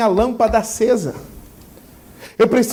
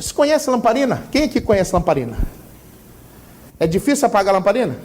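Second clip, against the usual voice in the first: normal talking voice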